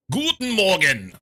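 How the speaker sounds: loud, slow, high mock German bark; hammed-up German accent, booming it out; German greeting. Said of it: "I sound like a true German".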